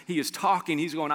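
ordinary talk, nothing marked

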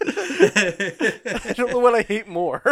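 laughing. Real, not acted. Laughing over a movie scene.

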